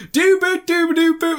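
high-pitched "doo-boo" tune; mock human jukebox; jukebox imitation. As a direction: toot out a silly jukebox noise, high and loud. Imitating the man playing jukebox.

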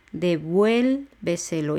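"Devuélveselo" slowly, leaning on "vuél"; stress on vuél. Shown as a demonstration. Teacher showing where the accent goes, slow stress on "vuél" in "Devuélveselo". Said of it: "el acento va en la E de vuel".

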